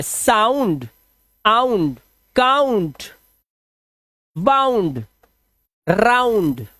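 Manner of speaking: "साउंड, काउंट, राउंड" as drawn-out, separate model words; pronunciation demo. Shown as a demonstration. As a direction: stretch the ow glide in "बाउंड" and "राउंड". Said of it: "आऊ की आवाज है".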